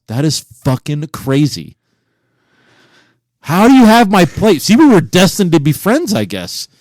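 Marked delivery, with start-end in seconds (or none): 3.4-6.1 high, loud astonishment; thrilled by the coincidence; amazed. Real, not acted. Host marvelling that cohost owns his old plate.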